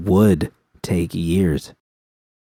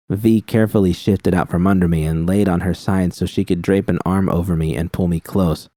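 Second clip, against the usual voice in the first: ordinary delivery, nothing marked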